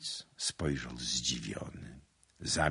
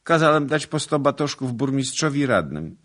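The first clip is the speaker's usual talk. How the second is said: loud, high, unbroken recital; all in one breath; 10 semitones higher, 10 dB louder than usual. A narrator's reading. Suitor confessing the floggings to his lady, one breath. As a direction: blurt the confession out without a pause. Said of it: "wyrecytował jednym tchem pan Andrzej".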